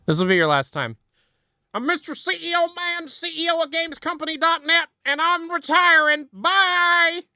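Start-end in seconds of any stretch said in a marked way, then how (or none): 1.7-7.2 very high cartoon executive voice; Mister C E O Man. Last time doing Mister C E O Man, very high mock voice. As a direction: squeak out the retirement like a tiny CEO.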